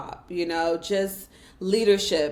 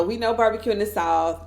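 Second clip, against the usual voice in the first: usual speaking voice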